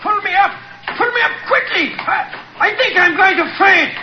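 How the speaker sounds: high, panicked cries to be pulled up; frantic high-pitched plea from a man dangling over a drop; terrified yelling. Acted. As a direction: yell up in terror, pitch cracking high. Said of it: "yelling at the top of his voice".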